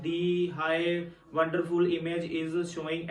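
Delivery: slow, choppy word-by-word reading. Shown as a demonstration. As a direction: read each word separately, haltingly. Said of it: "एक एक word करके ऐसे बोल रहे हैं". (एक-एक शब्द अलग करके धीमे पढ़ना)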